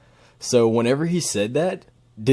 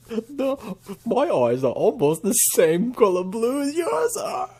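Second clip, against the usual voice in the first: slow, much higher, sweet-talking; mock flirty high voice; 12 semitones higher than usual. Flirting with the arrestee on video, a high mock coo. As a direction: coo it like a lovestruck fan.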